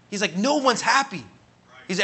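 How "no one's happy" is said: raised pitch on a retold outcry; impassioned realization. Young man confessing to his pastor, impassioned. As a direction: exclaim it like a sudden discovery.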